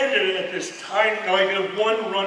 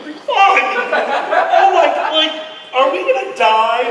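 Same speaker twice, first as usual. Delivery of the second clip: high, loud panicked yelp; hammed-up terror; mock panic; 7 semitones higher, 7 dB louder than usual. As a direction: freak out like the plane is crashing. Panicking mid-flight.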